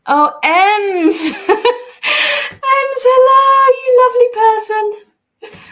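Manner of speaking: very high, loud delighted squeal; excited. Real, not acted. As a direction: squeal with joy and laughter. Delighted greeting to a fan.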